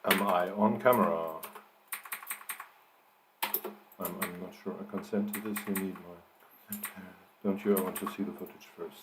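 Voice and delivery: rattled off quickly under the breath; fast, hushed re-reading of a draft; fast quiet read-back. Real, not acted. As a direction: skim the lines back to yourself, quick and soft.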